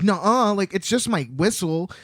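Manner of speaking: put-on high, loud little-kid denial; protesting small child. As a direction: argue back like a small child. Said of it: "fights a little bit".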